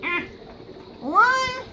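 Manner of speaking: ordinary talk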